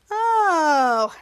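long, falling "ohhh"; stretched-out "oh" gliding down; awed group "oh". Retold or imitated. Choppa, Mika and Bose watch the plant grow, long falling oh. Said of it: "in unison was like".

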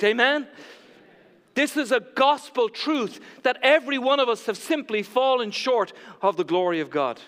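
loud, high, emphatic preaching; rally the whole church; ringing pulpit declaration. Real, not acted. Preaches at full force.

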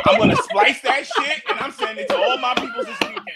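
teasing, laughing as the pitch rises; gleeful laughing threat. Real, not acted. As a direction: threaten it through laughter, voice climbing high. Gleeful threat to share the clip.

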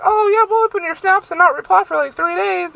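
high, airy mock of careless friends. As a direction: mimic friends brushing her off. Mocking friends who ignore snaps.

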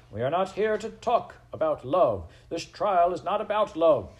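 high, loud scolding from a judge; angry shout. A narrator's reading. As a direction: shout the accused down, pitch climbing. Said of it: "shouted the very".

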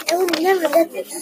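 mock angry yell. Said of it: "I yelled in failure and anger".

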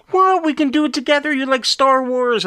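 high, eager wife impression; excited wife voice. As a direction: squeal it like an excited partner. Wife voiced in retold story.

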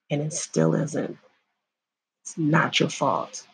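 soft reassurance. Real, not acted. To a survivor watching, soft reassurance. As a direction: ease their guilt quietly. Reassuring viewers who were assaulted.

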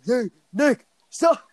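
put-on high, loud whiny girl voices calling his name; hammed-up van girls nagging; mock girls complaining. Guy imitating the girls in his van complaining, whiny and loud. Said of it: "every girl in my van was, like, complaining".

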